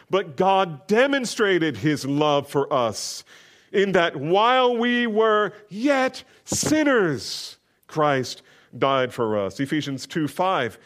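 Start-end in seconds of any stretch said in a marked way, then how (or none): 0.1-9.6 slow, soaring preached verse, higher and louder; fervent pulpit recital of Romans; scripture reading. Acted. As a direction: let the verse swell and ring out slowly. Reads scripture aloud.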